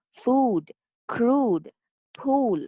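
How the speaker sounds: FOOD, CRUDE, POOL with a drawn-out oo; long U examples. Shown as a demonstration. Teacher giving examples of long U, long drawn vowels. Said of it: "it is pronounced as oo".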